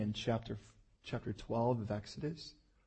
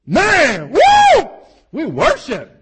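hyped-up shouting; 20 dB louder, much slower than usual. Retold or imitated. Mocking hyped-up worship crowd.

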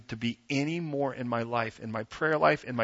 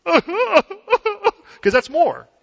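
mock showy laugh; 14 semitones higher, 9 dB louder, much slower than usual. Imitating a showy worshipper.

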